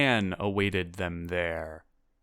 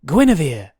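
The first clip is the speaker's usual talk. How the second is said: loud, high, excited name-giving; eager and loud; 7 semitones higher, 12 dB louder than usual. A narrator's reading. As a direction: blurt out your name excitedly. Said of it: "said Guinevere excitedly".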